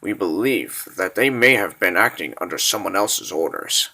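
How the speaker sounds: low, subdued military report; grave briefing. Acted. Officer briefing his lord on the kidnapping, grave and low. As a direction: report it softly and low, measured.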